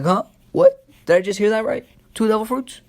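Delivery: high, loud stunned outburst; a startled pirate hearing impossible news, voice jumping up; shocked disbelief. Retold or imitated. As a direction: blurt it out in total disbelief. Buggy shocked at two fruits.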